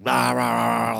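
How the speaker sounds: loud mock barking of a blind dog; playful imitated woofs. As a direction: bark it out like a big dog.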